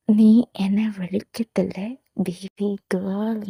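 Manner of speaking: slow, soft teasing whisper; flirty whisper. A narrator's reading. Groom flirting with his shy bride: slow teasing whisper. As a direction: coax her in a slow, playful whisper. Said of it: "flirt ചെയ്യുന്ന രീതിയില്".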